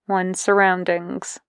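plain reading voice

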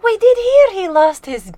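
character voice. Acted. Character talks to doorman.

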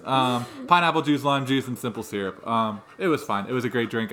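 usual speaking voice

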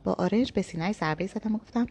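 ordinary delivery, nothing marked

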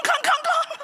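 high laughing clunk, clunk, clunk; a giggly, squeaky sound effect for each clunk; clunking noise. Retold or imitated. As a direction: mimic her feet clanging down steel steps. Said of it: "it went clunk".

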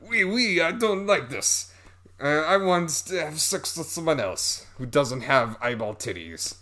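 high mock French accent, falling at the end; bad French accent. Man rejecting his strange lover, bad French accent. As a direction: put on a badly faked French accent. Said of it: "the French accents are bad".

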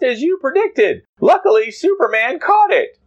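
squeaky excited Jimmy, high. Acted. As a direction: pitch way up for the kid reporter.

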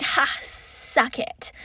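slow, whispered gloating; smug taunt. Retold or imitated. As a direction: tease them under your breath. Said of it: "is like".

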